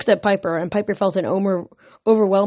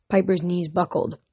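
ordinary delivery

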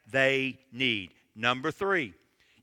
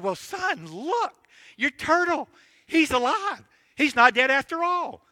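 much higher, amazed; surprised dad; 9 semitones higher than usual. Acted. Dad shows son the living turtle: high, amazed. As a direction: let the father's voice jump up in surprise.

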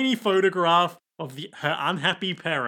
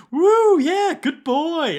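high, loud squeal of delight; over-the-top pet-owner cooing; gushing praise; 13 semitones higher, 7 dB louder, much slower than usual. Acted. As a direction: squeal like praising a puppy, drawn out. [name] praising the bird.

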